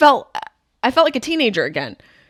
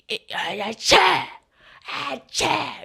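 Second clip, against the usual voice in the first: mock sneeze; 5 semitones higher, slower than usual. Imitating a sneeze.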